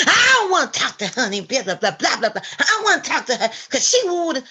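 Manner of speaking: high, loud mocking wail; hammed-up whiny outburst; Ethel's mock tantrum. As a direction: imitate a crybaby refusing to talk. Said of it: "What was her response?".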